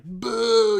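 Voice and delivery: loud, high, drawn-out mock boo; imitated crowd boo. Audience jeering the broken video, mock loud booing.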